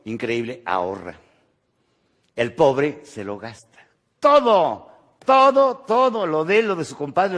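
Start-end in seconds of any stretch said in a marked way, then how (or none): 4.2-7.4 high, loud grasping "todo, todo, todo"; mock greedy grab. Speaker mocking the poor man who spends everything, high and loud. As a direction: rattle off everything the spender takes, higher and louder.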